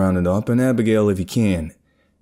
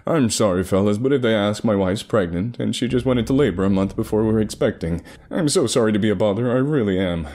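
snooty voice. Acted. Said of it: "this snooty voice".

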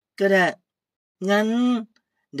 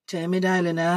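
normal talking voice

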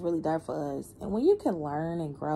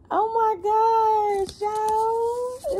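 overwhelmed; 4 dB louder, much slower than usual. Real, not acted. Moved by the reading.

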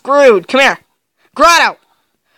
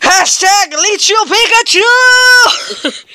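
yelled; 7 semitones higher, much slower than usual. Shown as a demonstration. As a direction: belt "Pikachu" out slowly, like a cheer. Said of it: "just yell in the".

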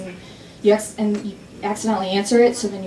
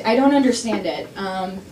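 ordinary delivery

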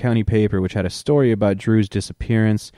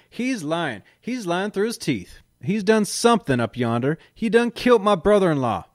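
accusing deputy; 11 semitones higher than usual. A narrator's reading. Deputy accusing narrator in voices.

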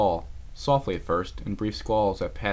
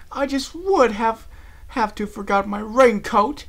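much higher, slow posh whine; complaining; 14 semitones higher, 8 dB louder, slower than usual. Acted. Rarity complains to Applejack about her raincoat. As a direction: whine like a fussy lady. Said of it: "she complained".

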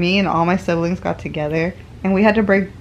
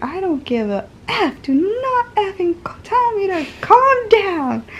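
angry yell; 13 semitones higher, slower than usual. Retold or imitated. Loopy patient yelling at husband about calm down. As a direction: snap back, furious and rising. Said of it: "I was just like".

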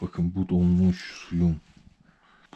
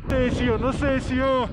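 high voice raised against the wind; shouting over wind; slower than usual. Real, not acted. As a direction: call out over a howling gale.

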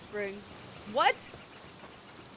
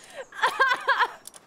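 high, loud cackling burst; helpless laughter; 13 semitones higher, 7 dB louder than usual. Real, not acted. Streamer cracks up over a mispronounced word, helpless laughter. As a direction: laugh till the pitch shoots up.

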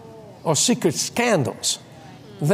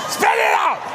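reenacted angry holler; mad shout. Imitating an angry warning to Kathy, mad shout. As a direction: shout it like a cross order. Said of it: "You were mad".

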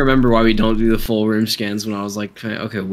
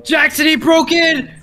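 panicking gamer; 14 semitones higher than usual. Real, not acted. Monster breaks in.

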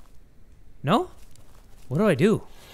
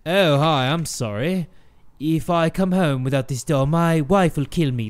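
low mock British voice; British accent; 5 semitones lower than usual. Stranger refuses the father, low British accent. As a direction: apologise in low-pitched put-on British. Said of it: "This man developed a British accent".

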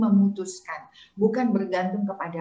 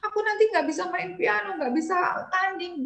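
higher-pitched worried child, imitated without real sobbing; mock tearful child; 8 semitones higher than usual. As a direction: imitate an upset child's high plaint. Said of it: "saya obatin dia nangis". (menirukan anak cemas dengan suara tinggi)

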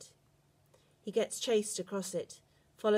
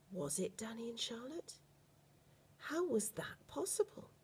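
whisper; 4 semitones higher, 7 dB quieter than usual. A narrator's reading. Stunned narrator whispers his disbelief.

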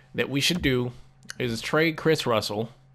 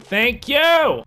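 loud, high "Thank you!" of triumph; excited relief; 10 semitones higher, 7 dB louder than usual. Real, not acted. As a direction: celebrate the accepted trade. Trade finally accepted.

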